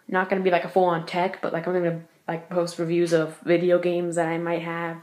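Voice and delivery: ordinary talk, nothing marked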